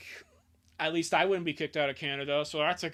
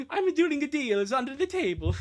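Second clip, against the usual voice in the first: put-on Canadian accent; 10 semitones higher, 7 dB louder than usual. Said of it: "Please do a Canadian accent for the Mountie".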